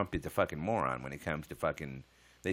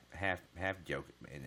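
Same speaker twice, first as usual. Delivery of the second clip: ordinary talk, nothing marked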